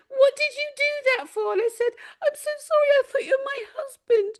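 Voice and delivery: high, teary, laughing apology; sob-laughing through the words; real tearful laughter; laugh-crying. Real, not acted. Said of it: "I was really crying".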